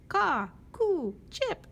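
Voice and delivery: high, loud chirpy bird calls; squeaky birdsong voice. Acted. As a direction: sing out like little birds. Birds still singing regular songs.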